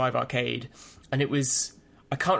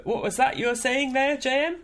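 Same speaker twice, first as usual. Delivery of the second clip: high, theatrical overacted question; hammy stage actor; 11 semitones higher than usual. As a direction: ham it up on stage. Mock theatrical prompt.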